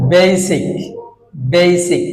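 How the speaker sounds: বেসিক as "bay-sik", falling; long ei glide in বেসিক; correct pronunciation. Shown as a demonstration. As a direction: slowly model বেসিক with a diphthong. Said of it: "right উচ্চারণ".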